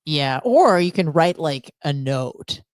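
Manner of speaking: usual speaking voice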